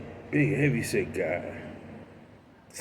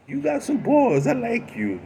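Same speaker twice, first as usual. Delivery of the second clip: amused tough guy; 13 semitones higher, 5 dB louder than usual. Retold or imitated. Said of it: "He started laughing".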